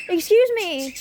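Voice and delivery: loud, high real shout; exasperated calling out. Real, not acted. Vlogger breaking off her talk, exasperated shout. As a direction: break off and yell for attention.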